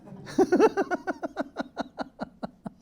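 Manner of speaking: a burst of high, delighted laughter; imitated gleeful giggle, pitched high. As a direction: laugh high and freely, tickled. Elder's delighted laugh retold.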